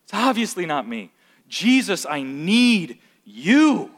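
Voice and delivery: a believer's desperate confession; humbled plea. Retold or imitated. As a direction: realize you fall short and reach out. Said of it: "we should feel like".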